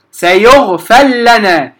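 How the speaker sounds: slow demo of سيغفر لنا with the ra merged into the lam; slow Quranic demo of سيغفر لنا, ra merged into lam; idgham demo. Shown as a demonstration. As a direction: drawl سيغفر لنا so no ra is heard before the doubled lam. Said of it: "أقرأ مباشرة للسوسي".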